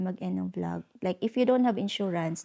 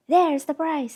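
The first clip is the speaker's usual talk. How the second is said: loud, high, sharp retort of a rude seller; retold yell; 7 semitones higher, 7 dB louder, slower than usual. Rude shop vendor yelling at a tourist, loud and high. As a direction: snap it back like the annoyed vendor. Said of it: "nabigla na lang niya akong sinigawan".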